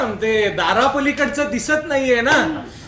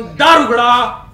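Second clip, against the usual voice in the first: slow, loud call through a door; 6 dB louder, slower than usual. Acted. Husband demands wife open the door, calling loudly. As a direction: stretch it out and demand entry.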